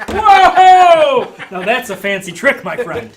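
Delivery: very high, very loud laughing whoa; astonished barker. Acted. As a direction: marvel at the trick. Barker amazed at fire.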